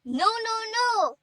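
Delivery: high, drawn-out cry of denial; panicked cry. A narrator's reading. Hannah finds her mother without heartbeat, panicked high cry. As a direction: cry out in disbelief, pitch leaping up. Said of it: "Hannah shouted and said".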